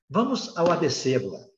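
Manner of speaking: ordinary delivery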